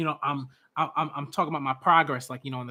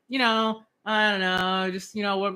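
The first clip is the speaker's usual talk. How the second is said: higher-pitched noncommittal waffling; mock excuse spiel; 4 semitones higher than usual. Fan mimicking the excuse the leader didn't give, mock waffling. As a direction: fumble through a pretend excuse. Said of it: "some, like, nonsense and, like, some, like, spiel".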